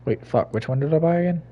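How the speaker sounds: ordinary talk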